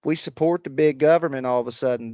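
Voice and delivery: hammed-up Southern accent; fake Southern accent. Shown as a demonstration. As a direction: lay on a fake Southern MAGA voice. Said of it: "That is a fake accent".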